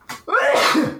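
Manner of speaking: sudden real sneeze. Real, not acted. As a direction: sneeze away from the mic. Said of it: "I'm gonna sneeze".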